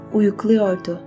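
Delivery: ordinary delivery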